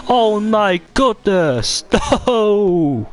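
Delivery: high, slow, laughing in disbelief; real incredulous laughter; stunned laughing. Real, not acted. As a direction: laugh through the shock. Reacting to crash, laughing.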